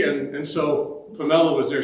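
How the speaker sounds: ordinary talk, nothing marked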